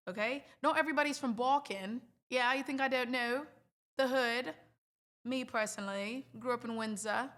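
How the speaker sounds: put-on London British accent. Shown as a demonstration. Streamer answering a critic of her accent, British accent. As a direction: defend yourself in a cheeky British accent. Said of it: "says my British accent is terrible".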